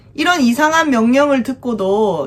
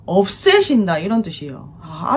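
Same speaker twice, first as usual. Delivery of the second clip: ordinary talk, nothing marked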